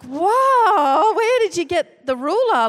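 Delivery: high, loud shocked shout; shocked cry. Retold or imitated. Wife shocked by husband's claim, high loud cry. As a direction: cry out in disbelief.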